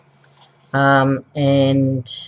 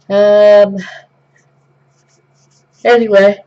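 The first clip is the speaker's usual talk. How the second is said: talking through a big yawn; words stretched by a loud yawn; 8 semitones higher, 8 dB louder than usual. Real, not acted. Mid-vlog the speaker yawns while talking. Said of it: "Sorry about that yawn".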